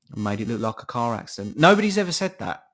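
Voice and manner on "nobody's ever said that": loud, high, exasperated burst; indignant outburst. Real, not acted. Ranting to listeners about a conspiracy, a loud indignant outburst. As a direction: blurt it out in disbelief.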